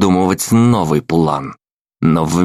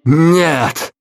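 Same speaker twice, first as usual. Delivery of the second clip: loud sharp refusal; almost yell it; near shout; 10 semitones higher, 3 dB louder than usual. Acted. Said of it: "чуть не заорал я". (почти крик)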